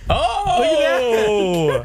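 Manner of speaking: long, high cry of reaction; drawn-out, high-pitched "ohhh"; excited "oh". Real, not acted. Player reacting to a rock, paper, scissors result, excited cry.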